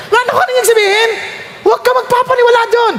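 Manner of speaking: high, urgent warning; preacher urging the congregation not to believe, high and urgent; emphatic warning. Real, not acted. Preacher warns, pitch jumps high.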